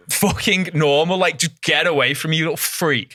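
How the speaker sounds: retold irritated outburst; exasperated telling-off. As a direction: tell off a clingy stranger, comically fed up. Acted rebuff of clingy stranger.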